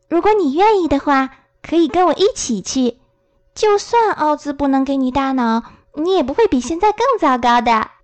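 略高的小女孩声音，认真介绍自己; 稍稍提高音调扮小女孩; 小女孩朵洛西. Acted. Narrator voices Dorothy. (轻度扮演的小女孩嗓音)

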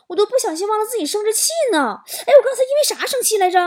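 high, quick, baffled question; hammed-up puzzlement, pitched high; sudden puzzled outcry. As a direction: call out as if you suddenly lost track. Wife suddenly calls out to husband.

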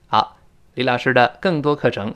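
ordinary talk

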